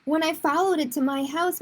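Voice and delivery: usual speaking voice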